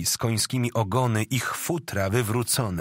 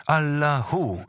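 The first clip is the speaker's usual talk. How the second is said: high-pitched war cry, mildly raised voice; battle cry; 6 semitones higher, slower than usual. A narrator's reading. Tatar horde crying out as it attacks, restrained battle cry. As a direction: call it out like a charging horde, with some restraint. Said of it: "wrzasły hordy".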